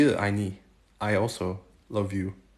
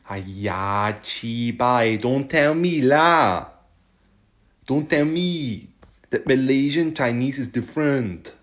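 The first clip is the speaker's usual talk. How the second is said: hammed-up Malaysian Chinese English with a loud lah; Malaysian Chinese accent; 3 semitones higher, 6 dB louder, slower than usual. Imitating Malaysian Chinese speech for a stream viewer, loud Malaysian accent. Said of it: "Nice imitation".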